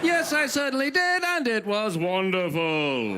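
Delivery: lightly done British announcer voice. Pretend. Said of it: "Let's talk like British T V announcers".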